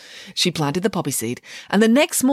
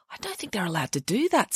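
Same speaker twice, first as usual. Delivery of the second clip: whispering; 2 semitones higher, 6 dB quieter than usual. Acted. Samantha voiced in whisper.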